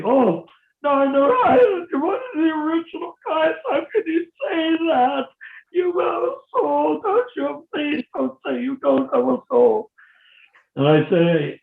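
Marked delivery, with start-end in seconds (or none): 0.0-9.9 high-pitched wailing mock protest; hammed-up pleading in a much higher, tearful voice; aghast devotee. Devotees begging the speaker not to deny the soul, mock wailing. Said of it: "they look at me".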